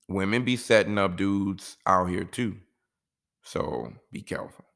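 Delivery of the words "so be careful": whispered, soft and low: "So be careful"; hushed warning. Real, not acted. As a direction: lean in and breathe "So be careful" as a secret warning. Warning single fellas about setups.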